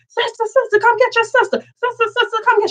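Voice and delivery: high wailing call for her sister; girl hollering. Retold or imitated. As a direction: shriek for the sister to come. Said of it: "She hollering".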